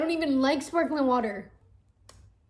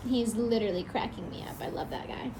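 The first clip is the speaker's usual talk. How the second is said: whispered fondness; soft whispered aside; 6 dB quieter, faster than usual. Real, not acted. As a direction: whisper it like a secret.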